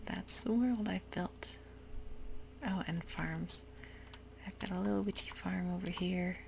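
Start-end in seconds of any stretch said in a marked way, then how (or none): none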